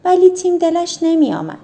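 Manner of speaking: plain reading voice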